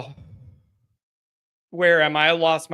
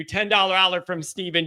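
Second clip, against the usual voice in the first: usual speaking voice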